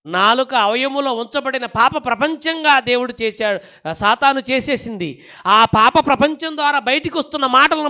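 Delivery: high, loud sermon building in intensity; full-force preaching. Real, not acted. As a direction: thunder the warning at the congregation.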